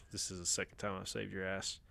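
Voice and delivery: hushed whisper. Retold or imitated. Stallone quoted in a whisper.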